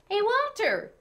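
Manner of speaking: put-on boy calling to brother. A narrator's reading. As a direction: call to brother across room.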